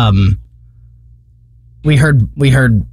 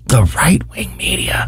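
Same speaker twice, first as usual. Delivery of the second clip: mock whisper; 3 semitones higher, 5 dB quieter than usual. Spooky whispered echo of phrase.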